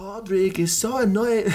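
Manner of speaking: high, badly faked Polish accent. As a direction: try a clumsy Polish accent. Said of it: "I can't do a Polish accent".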